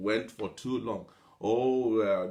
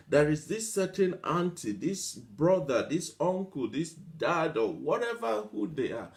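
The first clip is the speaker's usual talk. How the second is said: ordinary delivery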